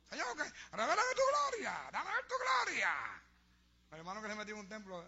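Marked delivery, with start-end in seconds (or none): 0.1-2.9 mock fervent plea, high and drawn-out; shouting at the altar. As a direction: wail to God like a showy worshipper. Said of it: "gritando en el altar". (gritos fervorosos imitados ante el altar)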